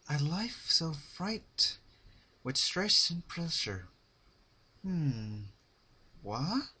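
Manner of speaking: high, slow dramatic swoon; Rarity sighing then startled by a flap. Acted. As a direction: savour it, then catch in surprise.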